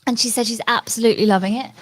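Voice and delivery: usual speaking voice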